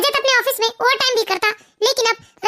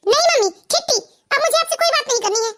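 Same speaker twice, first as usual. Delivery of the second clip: a small daughter's hurt, pouting voice, a bit higher; sulking little girl; 4 semitones higher than usual. Acted. Little daughter tells her mother she is a bad mummy, sulking. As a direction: pout and turn away like a cross child.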